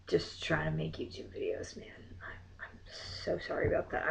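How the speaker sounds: whispered sheepish apology; softly whispered sorry. Real, not acted. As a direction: whisper it, embarrassed.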